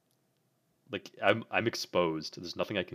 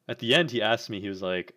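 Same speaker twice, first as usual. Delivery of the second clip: ordinary talk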